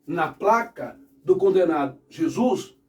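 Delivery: ordinary talk, nothing marked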